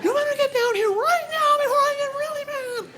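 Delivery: very high squeaky pretend yell; wife's feeble yell. Wife calls the boys to dinner in a high mock yell. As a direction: shriek upstairs with no real volume. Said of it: "Watch her walk to the bottom".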